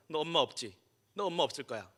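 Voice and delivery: ordinary talk, nothing marked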